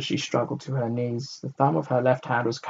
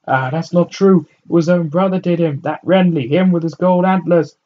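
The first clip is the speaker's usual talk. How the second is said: contradicting woman; 8 semitones higher, 10 dB louder than usual. A narrator's reading.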